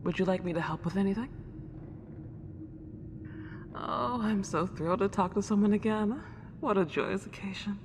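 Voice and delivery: a lonely house ghost, delighted to have company; Celine, old-fashioned ghost. Acted. Celine greets the new owner of her house, soft and old-fashioned.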